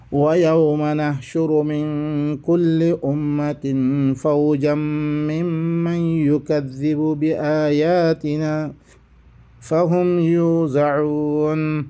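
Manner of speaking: slow melodic Quranic chanting; Quran recitation. Acted. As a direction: draw out each word in recitation.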